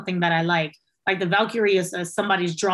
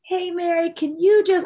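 wheedling favor-asking; 8 semitones higher than usual. Retold or imitated. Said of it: "you can tell by the tone".

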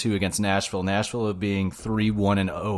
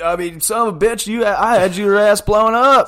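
much higher, much louder put-on old man; old wrestling host; 8 semitones higher, 9 dB louder than usual. Imitating a wrestling legend grilling a guest, hammed up. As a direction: ham up a cranky old interviewer.

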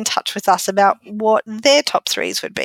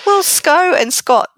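Sco and Scott, slow and high; drawn out Sco and Scott; stress on Sco and Scott; 9 semitones higher, 4 dB louder, slower than usual. Shown as a demonstration. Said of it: "they're the same amount of syllables".

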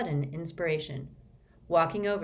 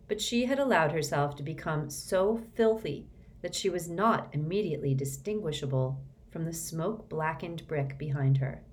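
plain reading voice

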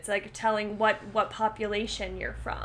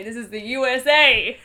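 imitated patriot friend; 8 dB louder than usual. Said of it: "No, she would say".

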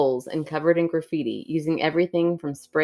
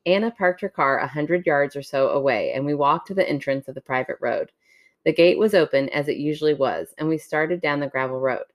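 story narration; 2 semitones lower than usual. Acted. Reads a creepy story aloud.

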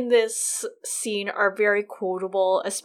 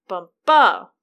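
"bum" light, "ba" punched much louder; iambic stress; 9 dB louder than usual. Shown as a demonstration. Host demonstrates an iambic foot, stress on "ba". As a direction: land hard on "ba" after a light "bum". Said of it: "unstressed syllable followed by a stressed".

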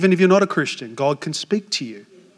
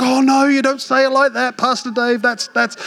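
youth correcting; 7 semitones higher than usual. Retold or imitated. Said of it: "And I'm like".

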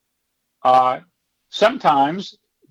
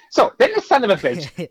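chatty woman; 5 semitones higher than usual. Retold or imitated. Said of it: "this woman's going".

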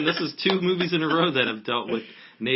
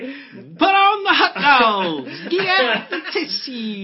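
put-on Southern accent; 5 dB louder than usual. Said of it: "You're like".

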